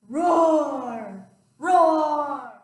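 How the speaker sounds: repeated dinosaur roars. Acted. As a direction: roar again and again. Roaring all weekend.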